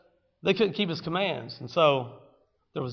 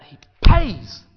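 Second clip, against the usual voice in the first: loud, high preaching proclamation; emphatic shout; 12 semitones higher, 11 dB louder than usual. Real, not acted. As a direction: proclaim it with full force. Sermon climax.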